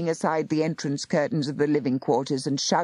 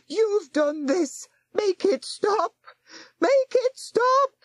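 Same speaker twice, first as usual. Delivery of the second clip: hysterical pleading; slower than usual. A narrator's reading. Terrified woman begging the warlock to stop it, screaming. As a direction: beg in growing panic until it breaks into a shriek. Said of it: "she howled at Nathaniel".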